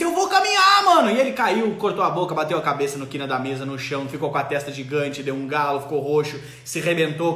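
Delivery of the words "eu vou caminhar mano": put-on much higher, louder proud little-kid voice; toddler's boast. Toddler announcing he will walk, high kid voice. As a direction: play the determined toddler. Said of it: "ele disse".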